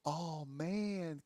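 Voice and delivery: softly imitating an unexcitable friend; Grayson, soft-spoken. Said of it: "soft-spoken".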